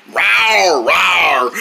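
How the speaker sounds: playful falling rawr; pretend dinosaur roar. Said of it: "pretending to be a dinosaur".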